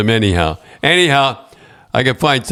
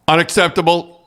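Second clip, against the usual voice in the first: higher-pitched mock outrage; mad league boss; 7 semitones higher than usual. League boss scolding the fined coach over the phone, mildly angry. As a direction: lay down an angry verdict, mildly acted. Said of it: "boy, was he mad".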